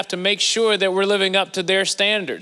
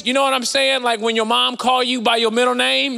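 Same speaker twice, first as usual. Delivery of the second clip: usual speaking voice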